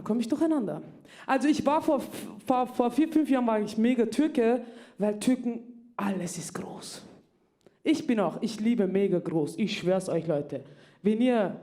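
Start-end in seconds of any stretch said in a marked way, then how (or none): 5.0-7.0 breathy whispered aside. Acted. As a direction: whisper it like a secret. Whispered aside about Turks.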